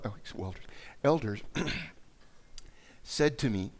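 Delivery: normal talking voice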